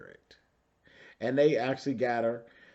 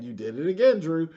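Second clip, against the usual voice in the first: usual speaking voice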